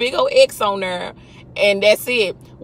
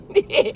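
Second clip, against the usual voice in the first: a short high burst of laughter; 12 semitones higher than usual. Real, not acted. Laughing at her own rant. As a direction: laugh at your own joke.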